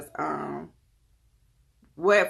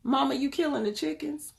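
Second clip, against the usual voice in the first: put-on child voice; 8 semitones higher than usual. Mother voicing her three-year-old son.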